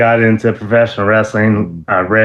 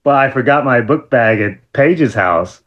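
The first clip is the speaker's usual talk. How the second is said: ordinary delivery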